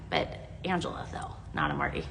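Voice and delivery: soft, breathless teasing whisper; whispered aside. Real, not acted. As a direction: slip in a private whisper joke. Whispered aside about a friend.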